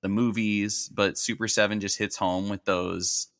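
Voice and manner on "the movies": normal talking voice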